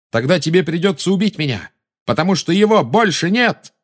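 high, defiant cry; Garri calling back. A narrator's reading. Boy answers the witch across the hall, calling back high. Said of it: "откликнулся Гарри".

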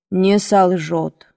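slow, low musing; pensive. A narrator's reading. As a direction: repeat it to yourself, lost in thought. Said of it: "повторил он задумчиво".

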